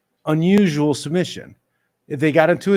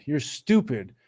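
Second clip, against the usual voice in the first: ordinary talk, nothing marked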